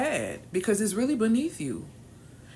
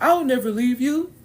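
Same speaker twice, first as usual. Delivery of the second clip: louder, higher, hammed-up promise; mock heroic vow; 6 semitones higher, 10 dB louder than usual. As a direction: play the eager savior, overdone. Mock rescuer vow to man.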